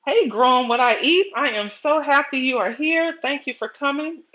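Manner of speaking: high, loud, happy welcome; excited greeting. Real, not acted. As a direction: welcome the viewer with a beaming lift. Greeting a viewer on livestream.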